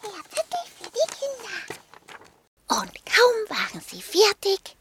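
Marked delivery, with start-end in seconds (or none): 0.0-1.6 higher, quick, excited dog voice. Acted. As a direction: squeak it out, pleased. Dog pleased with the note.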